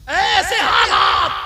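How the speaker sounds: slow, very loud and high proclamation; dramatic shout. Real, not acted. Preacher rails at the crowd about these conditions, slow shout. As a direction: stretch it out in outrage.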